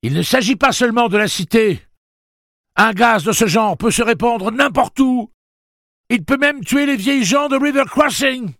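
very high, loud pleading shout; desperate yell. Acted. Jake pleads with Blaine: very high loud shout. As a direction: keep shouting over the noise.

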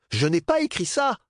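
high, loud, angry denial; grumbling denial. Acted. Bernard insists to Dap he didn't write it, high loud grumble. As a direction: protest it again, heated. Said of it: "maugréa Bernard".

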